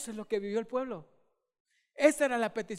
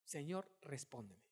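hushed prayer; 8 semitones lower, 15 dB quieter than usual. Acted. Repeating Elijah's prayer quietly.

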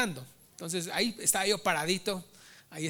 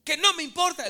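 indignant, higher-pitched retold yell; imitando gritos; 7 semitones higher, 5 dB louder than usual. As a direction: imitate someone stubbornly refusing to budge, raised voice. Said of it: "Estaba gritando, diciendo". (imitando a alguien que discute a gritos)

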